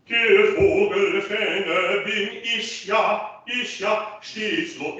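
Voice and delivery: slow, high-pitched intoned German lyric; slow, sing-song diction demo; demonstrated German diction. Shown as a demonstration. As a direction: draw out the lyric high to model each sound. Coach intones lyric to model diction.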